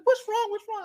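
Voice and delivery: high, loud, panicked questions; alarmed family. Retold or imitated. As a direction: rush out asking what's wrong. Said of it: "they're running like".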